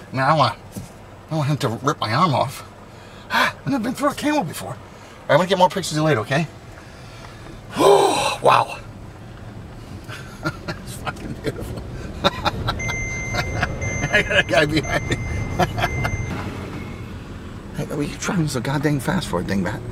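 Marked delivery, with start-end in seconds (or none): none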